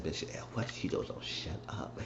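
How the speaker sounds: whispered aside, imitating onlookers; very soft, whispery mock grumble; hushed aside. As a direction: mutter it under your breath like a bystander. Acting out onlookers' muttered reaction.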